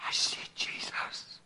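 slow whisper of wonder; hushed awe. Retold or imitated. Father whispering his vision, slow and hushed. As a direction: breathe out "I see Jesus" in awe.

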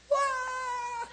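high wailing cry imitation; mock baby wail. Little girl in the back seat bawling, mock wail.

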